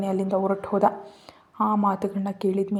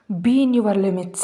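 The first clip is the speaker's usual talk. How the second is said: furious warning; 6 dB louder, much slower than usual. Acted. Slapping him, threatening with finger.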